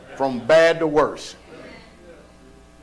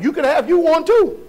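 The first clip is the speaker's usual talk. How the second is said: excited teasing; 6 semitones higher than usual. Real, not acted. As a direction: rib them with a grin. Pastor teasing the congregation.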